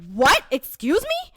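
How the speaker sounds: loud, high, indignant outburst; zero to a hundred. Acted. Speaker told she doesn't know, instantly indignant. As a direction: explode in instant disbelief. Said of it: "zero to a hundred real quick".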